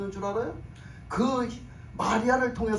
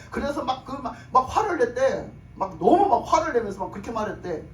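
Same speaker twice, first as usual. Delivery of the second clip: usual speaking voice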